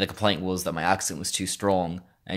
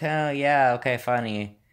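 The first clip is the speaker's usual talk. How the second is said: higher, awkward polite brush-off; awkward forced reply; 5 semitones higher than usual. Retold or imitated. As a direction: play along uneasily with a stranger's joke. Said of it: "it's, like, awkward".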